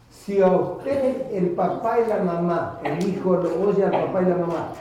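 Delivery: ordinary talk, nothing marked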